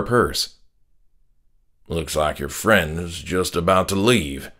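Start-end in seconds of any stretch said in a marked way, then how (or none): 1.9-4.5 sneer. A narrator's reading. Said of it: "my dad sneered over his shoulder".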